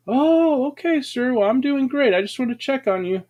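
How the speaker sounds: a cheerful nurse brushing off the patient; chirpy nurse. Retold or imitated. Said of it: "nurse answers in the retold scene".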